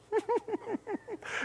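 high-pitched quiet giggle; retold giggling. He looked at her and laughed, high soft giggle. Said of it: "Why are you laughing?".

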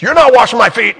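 high, loud refusal; indignant Peter. Pretend. Peter refusing Jesus at the footwashing, high and loud. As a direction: shout it high, outraged.

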